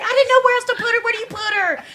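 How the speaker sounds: high, loud, hurried mock protest; flustered defensiveness. Mom excusing where she put Nana, flustered. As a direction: play mom frantically defending herself.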